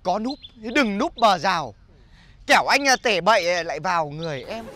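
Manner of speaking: slow, drawn-out comic verse recital; poem recitation. Acted. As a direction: declaim a silly love rhyme.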